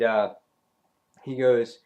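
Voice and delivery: usual speaking voice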